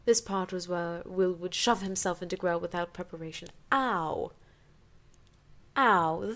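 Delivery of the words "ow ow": loud pained wince; loud sympathetic ow; sympathetic yelp. Real, not acted. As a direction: yelp as if it hurt. Reader reacts to painful scene.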